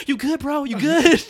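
high, loud mock concern; concerned bro. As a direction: check on a buddy.

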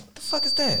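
high startled blurt at a strange find; shocked what-the. Retold or imitated. A boy finding grease on the floor, startled. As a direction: jump up in pitch, caught off guard. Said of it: "and I'm like".

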